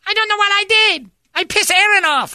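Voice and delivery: very high, loud frantic pleading; panicked waitress voice. Retold or imitated. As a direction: squeal it like a scared young waitress. Frightened waitress quoted.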